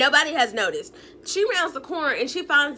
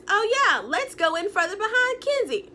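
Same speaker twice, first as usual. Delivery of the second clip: higher, sing-song suck-up voice, mocking Gabe; mock eager suck-up; 8 semitones higher than usual. Mocking Gabe tagging along behind Kenzie, high sing-song. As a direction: lightly play the eager tagalong.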